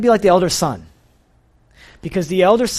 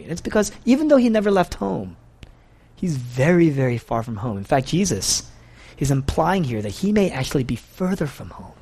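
ordinary talk, nothing marked

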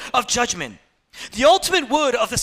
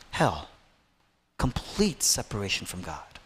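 very low, soft, sparse delivery; grave pulpit hush; hushed desolation; 12 semitones lower, 6 dB quieter than usual. Real, not acted. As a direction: let each phrase fall into long silence. Describes God's silence and abandonment.

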